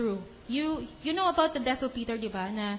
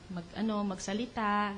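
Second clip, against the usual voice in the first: normal talking voice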